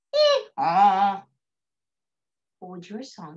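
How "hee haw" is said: donkey bray. Shown as a demonstration. Teacher ending the donkey song, donkey bray.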